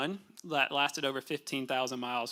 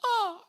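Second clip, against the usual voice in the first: high fainting "Oh" as she keels over; short high swooning gasp; mock faint gasp. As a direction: imitate a lady gasping as she faints. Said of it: "the lady just passes out".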